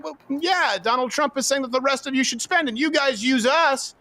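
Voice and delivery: high, excited mock Polish leader; voicing the Polish leader. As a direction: play the Polish leader pleading.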